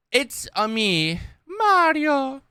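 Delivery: high, stretched Italian plumber voice with a rising cry on Mario; a quick playful Mario call as he reads the title; Mario impression. Reading the video title to his stream, doing a Mario voice.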